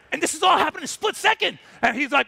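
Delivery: usual speaking voice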